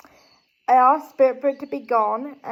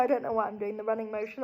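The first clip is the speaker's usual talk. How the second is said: ordinary delivery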